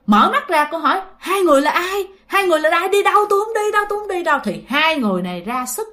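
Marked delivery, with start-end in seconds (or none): 1.2-4.4 high, panicked refusal; woman refusing the demons pulling her away; frightened protest. Retold or imitated. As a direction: cry out in terror, much higher. Woman resists demons dragging her.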